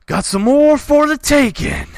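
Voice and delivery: a bandit chief breaking into a house; bandit king. Acted. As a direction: bellow to his men.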